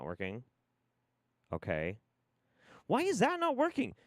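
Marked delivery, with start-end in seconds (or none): 2.9-3.9 high-pitched, whining frustration; incredulous high complaint; exasperated disbelief. Real, not acted. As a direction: plead with the gear, pitch way up. Another fix has failed.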